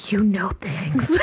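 gasp, then slow impressed whisper; hushed mock amazement after a gasp; awed whisper. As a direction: imitate someone awestruck, whispering slowly. Acting out dad's impressed reaction.

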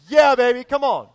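loud excited yell. Pretend. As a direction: shout it out, thrilled. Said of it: "I'd say".

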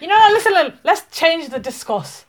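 a high, loud burst to grab the floor; excited interruption. Real, not acted. Cutting off the age talk, she bursts in excitedly. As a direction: jump in loudly to steer the talk.

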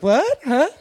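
high, loud confused "What? Huh?"; mock crowd confusion. Audience reacting to the comic's ex, puzzled. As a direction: play the baffled crowd.

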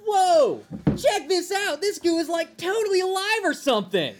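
put-on high, amazed commercial kid; excited ad kid. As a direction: gush like a kid in a toy ad.

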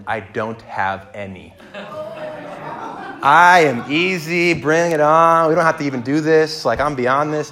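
smug, drawn-out bravado; breezy self-satisfied boast, stretching the words; proud millennial. Retold or imitated. Groom shrugging off expectations at premarital counseling, proud and cocky. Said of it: "in my proud millennial moment".

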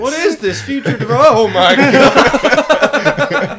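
loud, high, stretched-out shock; shocked outburst. Acted. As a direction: yell it in hammy disbelief. Voicing shocked young man.

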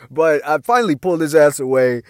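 ordinary delivery

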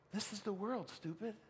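a hint of a chiding inner voice; soft mock voice. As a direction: murmur it like a gentle rebuke. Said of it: "the voice that says".

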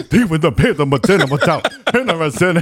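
garbled, laughing rap mimicry; mock a rapper overtaken by the shakes; shaking rapper imitation. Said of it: "like, starts shaking".

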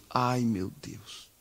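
slow, soft, defeated sigh; despairing sigh. Retold or imitated. Pastor told the building work must stop, despairing. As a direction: let it sink out of you slowly. Pastor hears the bad news.

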